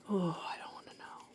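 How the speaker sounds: hushed, voiceless aside; whisper. Real, not acted. Painter whispering in dread about the red thing. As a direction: whisper it as if you'd rather not look.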